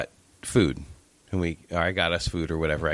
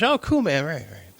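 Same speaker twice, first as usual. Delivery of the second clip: broken English; 6 semitones higher than usual. Retold or imitated. Dude chatting in the lobby, broken English. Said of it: "all broken English".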